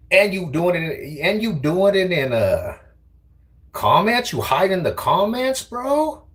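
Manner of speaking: high, sing-song mockery, slowing at first; squeaky ridiculing voice; high mocking taunt. Real, not acted. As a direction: tease him for hiding in comments. Mocking chatter hiding in comments.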